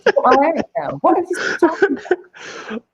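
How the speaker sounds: high, exasperated disbelief; retelling her own baffled reaction, high and laughing; incredulous high mock outrage. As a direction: squeak out your disbelief with a laugh. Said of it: "No, I'm like".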